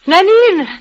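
calls the maid, high and loud; urgent call for the maid. Acted. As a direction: call across the room for Nanine.

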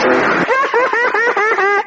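high eerie laugh from nowhere; a spooky laugh breaking in; ghostly laugh. Acted. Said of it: "What on earth was that?".

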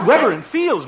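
high amused laughing question; laughing disbelief. Acted. Son mocks mother's old names.

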